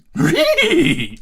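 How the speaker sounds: high, loud goofy cartoon snicker; cartoon dog cracking up; cartoon dog laugh.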